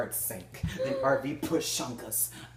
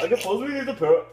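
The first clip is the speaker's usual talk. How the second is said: higher, fast, loud mock doubt; hammed-up dubious; 9 semitones higher, 7 dB louder, much faster than usual. Shown as a demonstration. Rereading the line again, even more dubious. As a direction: push the doubt further. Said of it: "let's say this dubiously".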